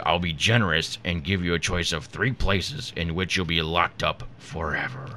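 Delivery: low, menacing Satan. Acted. Satan welcoming Bill Gates to eternity, devilish. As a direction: play the devil, low and measured. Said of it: "Good Satan voice".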